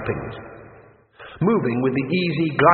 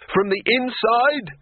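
much higher, slow sarcastic shout; 10 semitones higher, slower than usual. Acted. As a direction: yell back in exasperation. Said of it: "shouted Rincewind".

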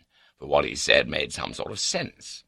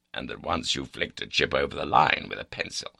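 usual speaking voice

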